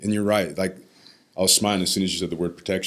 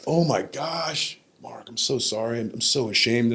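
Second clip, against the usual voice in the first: drawn-out, higher, imitated apology; retold shame; 4 semitones higher, slower than usual. Grandfather apologizing to grandson, retold ashamed voice. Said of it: "literally in shame".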